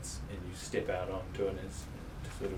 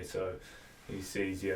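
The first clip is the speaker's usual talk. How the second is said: ordinary delivery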